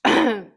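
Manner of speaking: sharp loud cough; choking cough. Real, not acted. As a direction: hack it out loudly. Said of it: "J'ai fait une fausse route".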